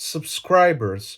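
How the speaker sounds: SUBSCRIBERS slowly, in careful native English; authentic English accent. Shown as a demonstration. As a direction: model "subscribers" with an English r, softly. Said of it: "Ή πες το με την ελληνική προφορά".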